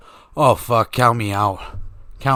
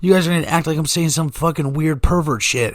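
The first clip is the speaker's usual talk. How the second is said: normal talking voice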